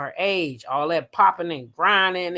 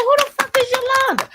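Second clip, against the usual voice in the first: very high, loud mock-exasperated question; baffled outburst; 5 dB louder than usual. Host at work getting mad, retold baffled outburst. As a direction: squeal it in retold bewilderment. Retells own baffled reaction.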